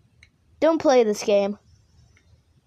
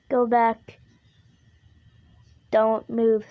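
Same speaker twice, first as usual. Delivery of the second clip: ordinary delivery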